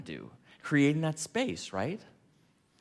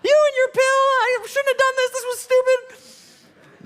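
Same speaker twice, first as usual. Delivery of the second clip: much higher, slow, louder mock woman's wail; a hammed-up woman regretting it all, high and drawn out; [name] panicking; 6 dB louder, slower than usual. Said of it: "There's my voice again".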